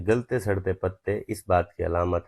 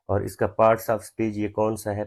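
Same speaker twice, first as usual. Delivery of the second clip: usual speaking voice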